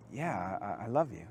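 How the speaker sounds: a shamed man barely getting the words out; faltering ashamed reply. Retold or imitated. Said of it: "Peter says".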